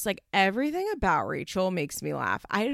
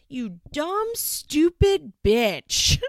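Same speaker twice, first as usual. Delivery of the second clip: high mock tantrum building to a loud yell; hammed-up brat insulting her mom, slow at the end; mock screaming teen; 8 semitones higher, 6 dB louder, much slower than usual. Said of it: "Rachel starts screaming at her".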